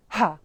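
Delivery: HA pushed out with a strong puff of air; short, forceful aspirate on "Ha"; aspirated H. Shown as a demonstration. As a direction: punch "Ha" from the belly. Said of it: "make an aspirate".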